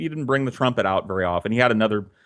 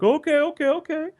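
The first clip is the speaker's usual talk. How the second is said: put-on 1 squeaky kid voice. Neighbor kid caves in.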